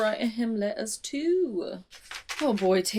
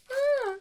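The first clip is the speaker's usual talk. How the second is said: short, very high squeal; 3 dB louder than usual. Real, not acted. Reader reacts to "I'm willing to die" with a high squeal. Squeal at dramatic line read.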